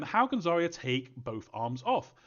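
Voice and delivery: ordinary talk, nothing marked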